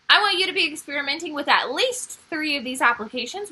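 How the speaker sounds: normal talking voice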